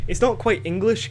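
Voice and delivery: ordinary talk, nothing marked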